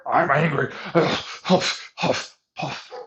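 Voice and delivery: mock angry huffing. Mocking how annoyed people react, hammed-up huffing. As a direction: ham up a fuming person's huffs.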